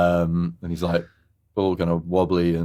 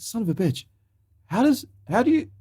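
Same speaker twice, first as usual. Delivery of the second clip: indignant Larry. Retold or imitated. Acting out incredulous Larry.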